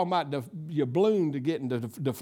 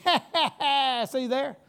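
higher, taunting "ha, ha"; mocking enemy laugh; 6 semitones higher, slower than usual. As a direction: gloat with a put-on laugh. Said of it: "the old enemy just laughing in my face".

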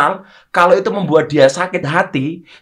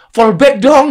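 higher, louder imitated plea; mock pestering; 9 semitones higher, 7 dB louder, slower than usual. Instagram friend asking to be followed back, mock pestering.

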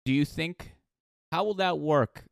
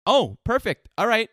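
excited; 9 semitones higher, 9 dB louder than usual. Real, not acted. Cheering as break starts.

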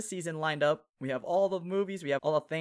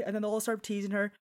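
normal talking voice

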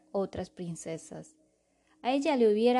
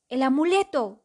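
loud alarmed cry; shouting; 5 semitones higher, 8 dB louder than usual. Acted. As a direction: shout it in sudden panic. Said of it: "gritó".

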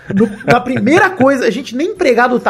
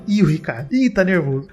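low, soft mocking murmur; quiet teasing; 6 semitones lower, 6 dB quieter than usual. Friend beside him teasing Ricardo, low and hushed. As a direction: tease him under your breath.

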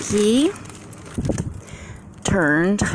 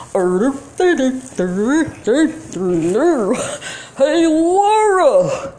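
calling out; 8 semitones higher, much slower than usual. Real, not acted. Calling Laura from the garage, drawn-out high call. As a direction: call her name so it carries.

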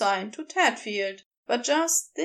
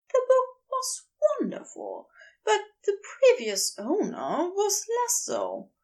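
higher, light voice for the fussy bookish man; chatty and a little prim, pitched up; Aziraphale voice; 10 semitones higher than usual. Acted. Aziraphale voiced telling of his day.

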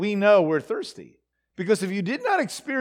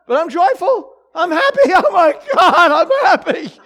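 higher, louder, hammed-up chanting of happiness; mock forced joy; 8 semitones higher, 8 dB louder than usual. Preacher mocking congregants forcing joy, hammed-up and loud. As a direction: parody someone forcing themselves to feel joyful.

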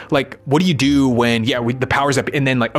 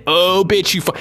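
mock outburst; 6 semitones higher than usual. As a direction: snap at him like an irritated critic. Voicing an angry critic.

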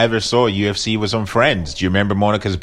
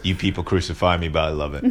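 normal talking voice

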